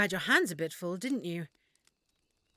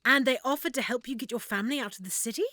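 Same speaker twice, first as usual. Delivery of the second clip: ordinary talk, nothing marked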